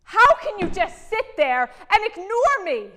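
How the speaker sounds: loud, high-pitched furious yell; angry outburst. Acted. Ignored wife confronts her silent husband, angry yell. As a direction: explode at someone who keeps ignoring you.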